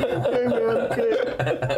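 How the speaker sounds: high, anxious dude voice; comic high slacker voice; lost dude. Acted. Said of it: "He's like".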